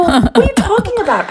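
high-pitched laughing disbelief; incredulous. Retold or imitated. Answering Jerry's praise, high laughing incredulity. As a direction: retell your astonishment in a high voice.

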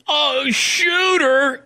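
high, slow, drawn-out mock fan voice. Host imitating the guy at the urinal, high drawn-out mock cry. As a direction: ham up the starstruck stranger.

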